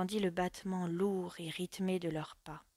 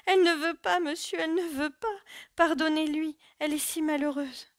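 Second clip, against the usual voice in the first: put-on much higher and louder, desperate old maid begging; old servant on her knees begs the officer to spare her mistress; crying out, pleading; 9 semitones higher, 8 dB louder than usual. A narrator's reading. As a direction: kneel and beg the officer for mercy.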